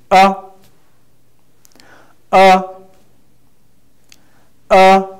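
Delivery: short, high "uh" cut off quickly; falling tone. Shown as a demonstration. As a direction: say "uh" briefly and loudly, high. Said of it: "The falling tone guides us".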